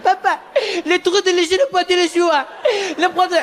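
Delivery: put-on high crying kid gibberish French; crying kid in fake French. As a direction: whine like a sobbing child.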